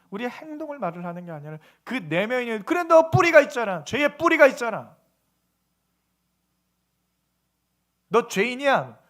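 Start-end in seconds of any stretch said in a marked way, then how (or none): 2.7-9.0 raised, louder acted accusation; accusing scripture. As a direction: point out the root like an accuser. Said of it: "성경은 뭘 말하는 거예요". (성경이 죄를 지적하듯 높인 목소리)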